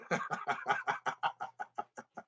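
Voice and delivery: real trailing, voiceless laugh; wheezy laughter. Real, not acted. Cracking up at own joke, trailing breathy laughter. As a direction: laugh until the breath runs out.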